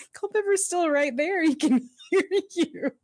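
high-pitched retold protest, breaking into laughter; mock exasperation. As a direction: laugh through a mock scolding.

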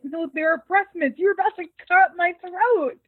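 high-pitched mock indignation, rising in volume; retold protest. Man explaining his fake pills to his would-be attacker, mock-indignant. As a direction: protest as if imitating someone narrowly spared.

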